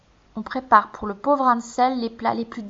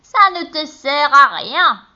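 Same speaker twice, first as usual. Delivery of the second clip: witch; 5 semitones higher, 6 dB louder, slower than usual. A narrator's reading. Witch scolding.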